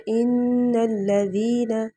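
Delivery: slow recited ان الذین with long nasal ghunna; tajweed reading of ان الذین; Quranic recitation. Shown as a demonstration. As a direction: chant ان الذین slowly, holding ان. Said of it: "جس طرح سے ہم پڑھتے ہیں".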